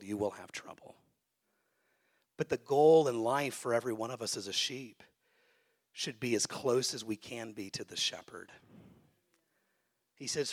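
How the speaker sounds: ordinary talk, nothing marked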